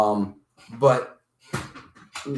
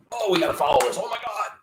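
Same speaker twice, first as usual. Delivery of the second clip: high-pitched breathless-news hype, put on; mock media excitement; 8 semitones higher, faster than usual. Mocking reporters rushing after a sensation, excited. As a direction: gush like a reporter chasing a hot story.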